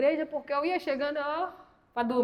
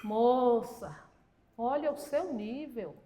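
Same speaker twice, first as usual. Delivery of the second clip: slow, low imitation of a reproving husband; drawn-out, measured telling-off; mock reproof; 3 semitones lower, 5 dB quieter, much slower than usual. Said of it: "ele é desse jeito".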